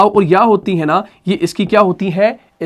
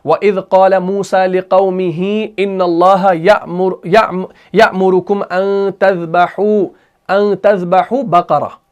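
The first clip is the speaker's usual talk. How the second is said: slow, deliberate Arabic recitation; Quran recitation; slower than usual. Shown as a demonstration. Teacher reciting verse sixty-seven, slow recitation. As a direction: recite slowly, holding long vowels and catching each hamza. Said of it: "सूरह बकरा की आयत number sixty seven".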